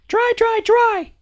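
put-on tiny high toddler chant. A narrator's reading. Little sister copying the family, tiny high voice. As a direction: squeak it out like a little girl learning words. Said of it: "because she is learning".

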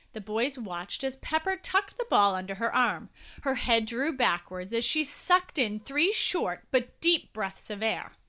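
ordinary delivery

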